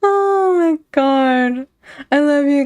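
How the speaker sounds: slow, high, tender oh my god; high, slow, touched thanks. Real, not acted. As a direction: melt at their kindness, slowly. Touched by chat.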